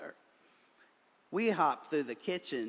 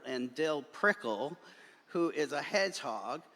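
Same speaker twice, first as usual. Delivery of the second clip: usual speaking voice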